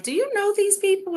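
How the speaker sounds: high, startled voice of a doubtful mother; mother's puzzled question. Retold or imitated. Stacy's mom questioning why she talks to strangers, high and puzzled. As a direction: ask it like a suspicious mom on a New York street. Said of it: "My mother was like".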